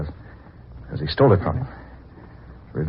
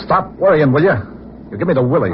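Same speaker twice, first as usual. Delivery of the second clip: higher, louder snap; irritated outburst; 9 semitones higher, 5 dB louder than usual. Acted. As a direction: bark at her to stop nagging. Snapping at nagging girlfriend.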